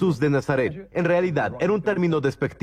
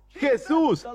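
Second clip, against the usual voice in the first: llamada muy aguda, sin mucho volumen; grito insistente imitado; 9 semitones higher than usual. Retold or imitated. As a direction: imita un clamor que insiste. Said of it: "gritó aún más". (imitando un grito agudo)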